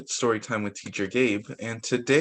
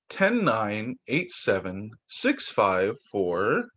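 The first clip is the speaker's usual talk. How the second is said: put-on reading to children; 3 semitones higher than usual. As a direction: count it out gently for the little ones. Reads counting book aloud.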